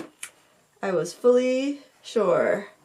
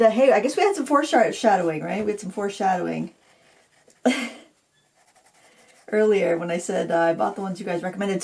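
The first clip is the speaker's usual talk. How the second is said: ordinary delivery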